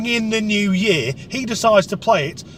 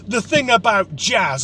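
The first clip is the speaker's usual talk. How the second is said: pompous bore. Retold or imitated. Music bore holding forth.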